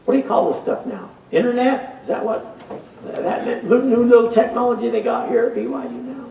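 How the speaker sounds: feigned confusion, pitched well up; a hammed-up clueless question about the internet; mock puzzled. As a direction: play the old man baffled by the internet. Joking about old-fashioned ignorance.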